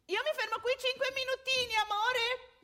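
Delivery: high, loud, fast chatter called across a distance. Acted. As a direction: call after him, half scolding. Calls after wandering husband.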